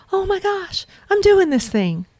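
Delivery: high, thrilled gush; excited. Retold or imitated. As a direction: squeal it like a breakthrough. Retold joy over the horse.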